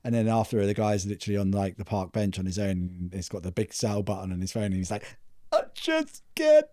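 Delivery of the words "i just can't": high, slow strained whine; anguished whimper. Retold or imitated. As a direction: wail it like you can't bear it. Guy alone on park bench.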